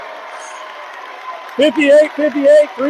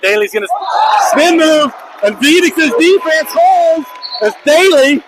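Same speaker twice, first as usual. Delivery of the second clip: higher and louder play-by-play shout; real commentator shout on a big defensive stop; excited shout; 5 semitones higher, 3 dB louder than usual. Real, not acted. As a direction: yell the spin move as it happens. Tense late-game play call.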